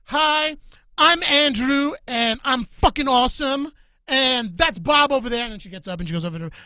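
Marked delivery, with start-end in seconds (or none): 0.1-5.4 higher and louder mocking bro voice; mocking dude voice. Girl mocking the podcast hosts, in a higher, louder bro voice. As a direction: ham up a cocky dude introduction. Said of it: "We don't sound like that".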